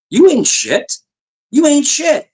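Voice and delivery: high-pitched retold put-down; mock-incredulous dismissal, voice way up; scornful comeback. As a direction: toss out the insult with pitch soaring.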